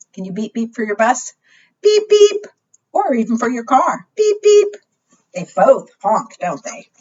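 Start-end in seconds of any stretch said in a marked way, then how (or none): 1.8-2.5 high, loud horn beep. Pretend. Teacher demonstrates car horn beep. 4.2-4.8 high, loud horn beep. Pretend. Teacher demonstrates car horn beep.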